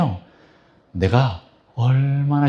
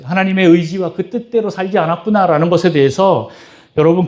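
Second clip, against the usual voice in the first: impassioned preaching; 6 semitones higher than usual. Acted. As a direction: pour out the pain of God to the congregation. Preaching climax on God's pain.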